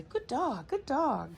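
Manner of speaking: good dog pitched high and lilting; sing-song high praise for the dog; excited praise. Shown as a demonstration. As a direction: gush with delight at the dog. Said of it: "the tone that you use".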